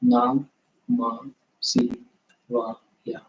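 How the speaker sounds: "Nam, Ma, Si, Va, Ya" spaced out, each syllable set apart. Shown as a demonstration. Teacher showing beginners each mantra syllable, spaced and deliberate. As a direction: break the mantra into its five separate syllables. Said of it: "Those are the five syllables".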